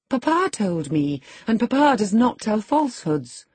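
put-on pert young girl, pitch lifting. A narrator's reading. Young lady defending her Papa's word, pertly. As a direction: answer back saucily. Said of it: "she answered pertly".

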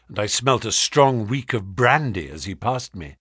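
ordinary delivery, nothing marked

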